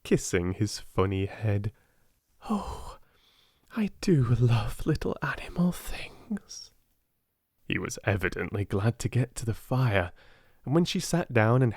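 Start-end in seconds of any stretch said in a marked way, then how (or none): 2.5-6.7 hushed, tender sigh of love; whispered fondness. Acted. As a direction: breathe it out fondly.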